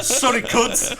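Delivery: mock Kiwi accent. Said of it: "I did go a little bit Kiwi".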